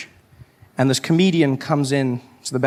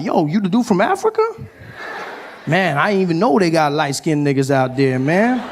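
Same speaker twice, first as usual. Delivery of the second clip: amazed American comedian; 3 semitones higher than usual. Acted. American comedian meeting a South African backstage, amazed dude voice. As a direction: marvel at meeting someone from Africa.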